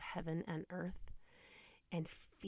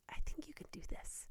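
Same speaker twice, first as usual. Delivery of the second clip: a soft, higher whisper of encouragement; gentle whisper; 9 semitones higher, 6 dB quieter than usual. Retold or imitated. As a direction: hush it to her like a kind nurse. Nurse encouraging her in labour.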